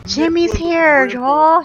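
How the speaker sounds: high, loud excited announcement; thrilled surprise. Real, not acted. Gamer tells viewers Jimmy is here, thrilled. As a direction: burst out with the big news.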